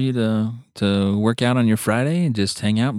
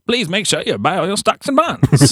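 put-on much higher old newsreel announcer; World War Two announcer; 8 semitones higher than usual. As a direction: sell war bonds like a 1940s radio voice. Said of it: "World War Two one".

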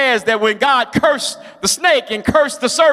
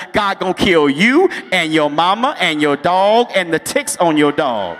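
normal talking voice

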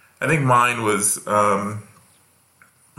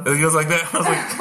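ordinary talk

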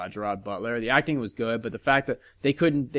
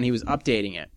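ordinary talk

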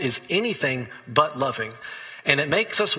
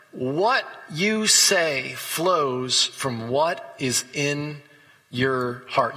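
drawn-out, weighty scripture quote; slow emphatic reading; much slower than usual. Acted. Preacher to congregation, reading Jesus' words very slowly. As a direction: lean on every word, very slowly.

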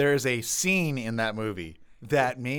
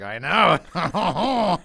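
raised, louder mock suitor; hammed-up suitor; 5 semitones higher, 7 dB louder than usual. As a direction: boast it loudly, playing the suitor. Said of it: "you're gonna be like".

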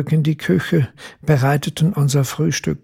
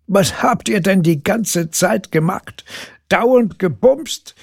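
leering tease; 5 semitones higher, 3 dB louder than usual. Acted. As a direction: drag out the crude joke with a grin. Bert teasing crudely.

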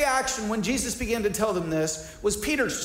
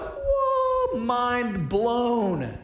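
awestruck; 2 semitones higher, much slower than usual. Acted. Preacher as disciples seeing the transfiguration, hammed-up awe. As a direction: act out having your mind blown. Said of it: "if you're Peter, James, and John, you're like".

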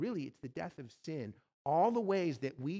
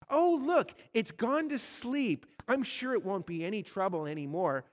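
wheedling ghost; 5 semitones higher, 5 dB louder than usual. Acted. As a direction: sweet-talk the angel to make him stop. Ghost tries to excuse lizard.

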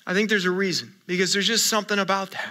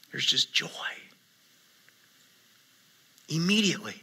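hushed tender; 6 semitones lower, 12 dB quieter, faster than usual. Real, not acted. Pastor recalls birth, joy hushed.

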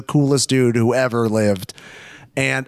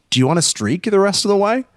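put-on playful girl; 7 semitones higher, faster than usual. Voices girl proposing a dare.

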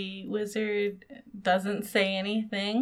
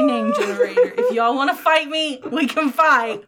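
laughing defensively; 6 semitones higher, 5 dB louder than usual. Real, not acted.

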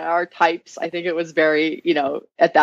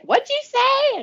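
much higher, mock outraged coach; coach's challenge; much slower than usual. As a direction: demand an answer, imitating the coach. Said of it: "Don's like".

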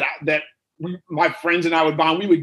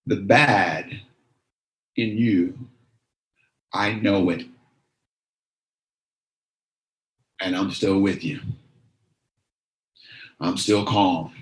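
lower, slow, tender; low reassuring; 10 semitones lower, slower than usual. Acted. Jesus reassures the ashamed woman, low and tender. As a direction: hold her gaze and reassure.

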